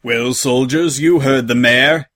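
commanding officer voice, drawn out; barked order. A narrator's reading. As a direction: bark it at a squadron. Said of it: "he barked".

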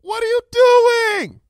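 high, loud, drawn-out shout; calling out. A narrator's reading. Pupil demands answers from the princess below, alarmed shout. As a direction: yell the question down from a height. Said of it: "I called out".

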